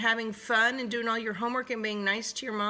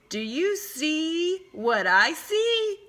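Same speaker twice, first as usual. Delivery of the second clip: higher, slow sly wolf voice; 9 semitones higher, much slower than usual. Acted. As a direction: tease it out slowly. Wolf asking slyly.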